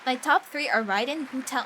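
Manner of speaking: ordinary talk, nothing marked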